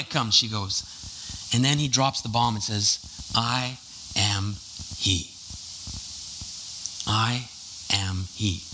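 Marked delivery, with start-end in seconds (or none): 3.4-8.6 low, soft, weighty pauses; solemn revelation. Retold or imitated. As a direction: drop the bomb quietly. Jesus reveals himself as Messiah.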